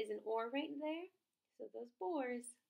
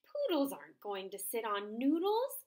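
gasped; 9 dB louder than usual. Acted. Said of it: "gasped the dog".

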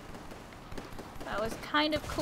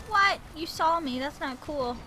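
normal talking voice

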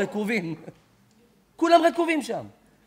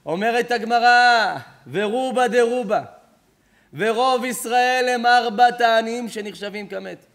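preach; 3 dB louder, slower than usual. Acted. Preacher's slow emphatic reading.

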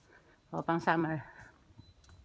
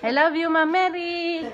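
high, slow, sing-song I love you; drawn-out affectionate declaration; playful gush; 7 semitones higher, 4 dB louder than usual. Real, not acted. Vlogger gushing affection to her manager, slow and high.

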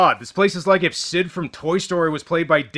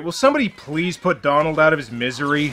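ordinary talk

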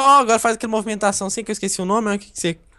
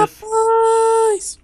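much higher, long falling "Rapaz"; amazed exclamation. Real, not acted. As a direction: let it slide down in disbelief. Reacts to friend's build. (espanto agudo e longo)

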